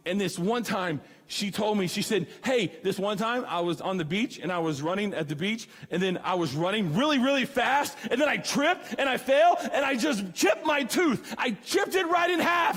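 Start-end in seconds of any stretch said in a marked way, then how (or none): 2.4-12.8 imitating a loud date. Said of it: "a loud woman who had no inside voice".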